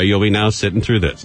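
ordinary talk

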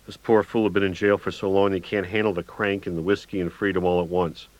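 ordinary delivery

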